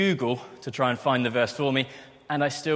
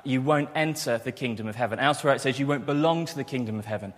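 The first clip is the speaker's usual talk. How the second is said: ordinary talk, nothing marked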